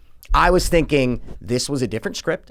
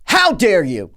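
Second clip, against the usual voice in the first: louder, higher outraged mock yell; retold scream; 6 semitones higher, 5 dB louder than usual. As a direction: re-enact yelling indignantly at the TV. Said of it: "I screamed at my television".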